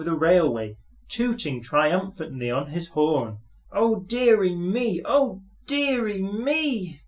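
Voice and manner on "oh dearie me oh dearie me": high, slow groaning lament; drawn-out high dismay. A narrator's reading. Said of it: "groaned Thomas".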